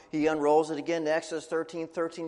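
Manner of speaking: ordinary talk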